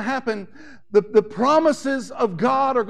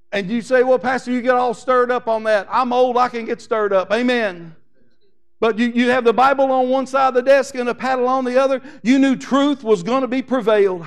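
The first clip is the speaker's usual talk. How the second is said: preaching; 2 semitones higher, 6 dB louder than usual. Acted. Pastor preaching to his church, loud and emphatic. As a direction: hammer each point home to the congregation.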